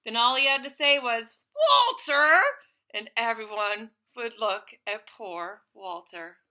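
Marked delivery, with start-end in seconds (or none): 1.5-2.4 loud sharp call of the name. Acted. Father scolding the dog by name, loud call. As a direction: bark the name across the room.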